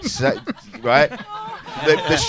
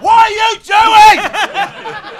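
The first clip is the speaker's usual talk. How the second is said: high, loud mock rage; hammed-up angry telling-off; furious manager; 12 semitones higher, 7 dB louder, slower than usual. Show manager raging at the MC, high and loud. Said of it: "apoplectic with rage".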